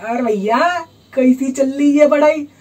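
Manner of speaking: put-on voice of a prying neighbourhood watchman; nosy watchman. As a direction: imitate a watchman checking up on studies. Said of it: "गली का watchman भी यही पूछता था".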